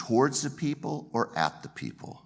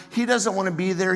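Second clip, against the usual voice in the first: ordinary talk, nothing marked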